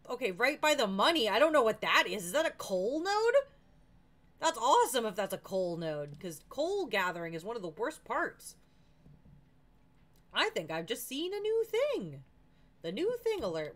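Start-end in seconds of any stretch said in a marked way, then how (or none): 0.1-6.2 loud, lifted excitement; real loud excitement at a find; excited discovery. Real, not acted. Spots diamonds and coal node. 10.3-13.8 loud, lifted thrill; excited announcement. Real, not acted. Announces a new find.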